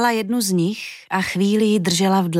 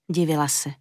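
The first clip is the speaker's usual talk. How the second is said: ordinary delivery, nothing marked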